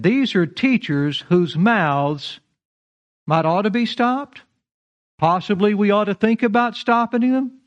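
mock hedging, softened misquote; a timid, hedging preacher, lightly voiced; playing a too-gentle Paul; mock wishy-washy Paul. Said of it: "No, he said".

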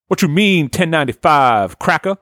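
high-pitched indignant challenge; hammed-up outraged customer; blaxploitation hero. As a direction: bristle at the price in a jive movie voice. Fro disputes the price.

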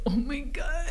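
whispered, overcome gasp of words; whispered emotion. Retold or imitated. Retelling getting emotional at the book, whispered awe. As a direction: breathe it out, mock tearful.

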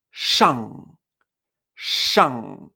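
shang with a nasal ng, mouth left open; Mandarin shang. Shown as a demonstration. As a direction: let the shang ring in the nose. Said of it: "fíjate".

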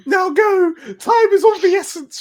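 high loud princess command; foppish princess. Acted. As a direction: urge them on as a fluttery princess. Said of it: "she says".

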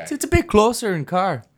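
high-pitched mock Canadian accent. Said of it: "your attempt at a Canadian accent".

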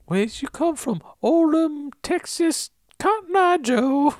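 very high, jokey Texan twang; hammed-up high Texas accent; Texas drawl. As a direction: sing out like a hoedown cowboy. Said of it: "Just your voice went up".

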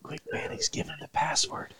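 unvoiced whisper. Real, not acted. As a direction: hiss it urgently under breath. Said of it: "whispered aside".

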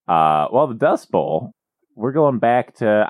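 normal talking voice

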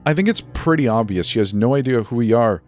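scoffing. Acted. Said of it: "Noah scoffed".

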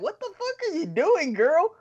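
high-pitched, loud disbelief; very high, incredulous outburst; incredulous exclamation. Retold or imitated. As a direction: squawk it in bewildered amazement.

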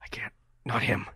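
urgent whisper; voiceless aside, not meant to be overheard; hushed pleading. Acted. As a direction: whisper it in reluctant protest. Overheard whispered argument in a scene.

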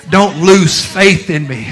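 loud, soaring preacher's cry; thundering pulpit call; full-force preaching. Acted. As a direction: preach it out to the back pews.

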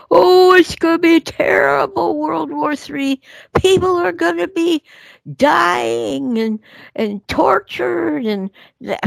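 high, breathless mock doom-saying; mock alarmist panic. Mocking the fearmongers' World War Three talk in a high mock-panicked voice. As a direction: ham up the panic the fearmongers push.

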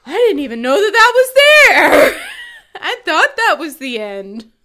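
high, loud mock astonishment; retold shocked discovery. Husband realizing he signed the wrong page, shocked. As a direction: exclaim it like a startled husband caught out.

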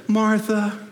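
first call, lifted and sustained; imagined Jesus inflection. Acted. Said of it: "it might have gone like this".

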